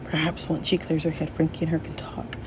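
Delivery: soft whispered suggestion. Acted. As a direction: whisper it confidentially.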